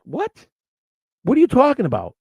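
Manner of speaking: high-pitched, baffled retort; incredulous. Retold or imitated. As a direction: voice leaps up in disbelief. Reacts baffled to brother's text.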